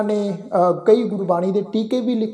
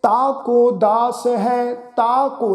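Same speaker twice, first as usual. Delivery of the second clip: slow, long-held syllables; recitation demo; much slower than usual. Shown as a demonstration. Teacher recites the line for the congregation, slow recitation. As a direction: draw out each word evenly. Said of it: "ਬਿੰਦੀ ਰਹਿਤ ਉਚਾਰਨ".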